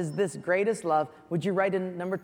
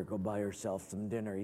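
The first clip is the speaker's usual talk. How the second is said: mock stingy churchgoer; 11 semitones lower, 9 dB quieter than usual.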